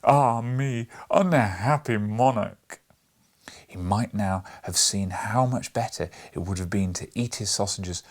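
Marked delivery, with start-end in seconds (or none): 0.0-2.8 loud, slow lamenting wail of a king; grieving king crying out, louder and slow. A narrator's reading. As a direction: wail it out in despair, drawn out. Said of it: "crying out every time in lamentable tones".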